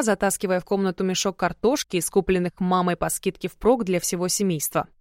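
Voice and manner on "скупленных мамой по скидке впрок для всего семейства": ordinary delivery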